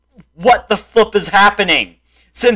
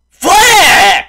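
high, loud curse at the game; angry shout; 7 semitones higher, 5 dB louder than usual. Real, not acted. Gamer yelling at the game after a fall. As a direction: bark it out in frustration.

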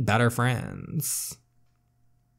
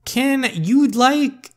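much higher, louder outraged whine; exasperated whine; 13 semitones higher, 6 dB louder, much slower than usual. Acted. Teen daughter snapping at mom, exasperated whine. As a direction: whine it in disbelief.

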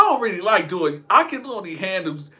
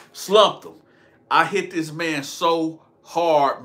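usual speaking voice

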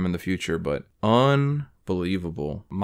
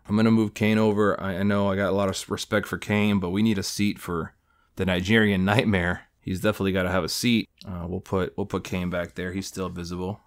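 ordinary talk, nothing marked

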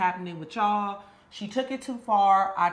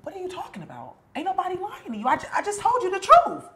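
desperate denial; 8 semitones higher, 5 dB louder, faster than usual. Acted. Desperate denial to best friend.

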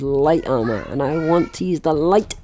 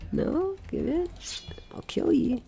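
high, soft playful menace; mock threat; 12 semitones higher, 10 dB quieter, slower than usual. Player playfully threatening a fleeing butterfly, high and soft. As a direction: tease the butterfly with a pretend threat.

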